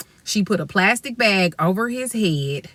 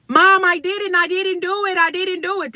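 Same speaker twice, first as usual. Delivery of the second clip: put-on pleading boy; 9 semitones higher than usual. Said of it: "This is his whole argument".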